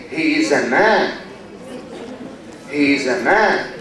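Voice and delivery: low pitch, "man" swelling and curving as praise; admiring emphasis on MAN. Shown as a demonstration. Teacher showing someone can be trusted, emphatic "man". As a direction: lean on "man", meaning really a man. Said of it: "He is really a man. You can trust him".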